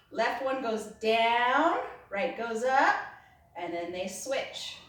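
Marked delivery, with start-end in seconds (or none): 0.1-2.9 slow, deliberate demo count; very slowly. Shown as a demonstration. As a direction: spell each move out slowly. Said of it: "Watch my circles very slowly".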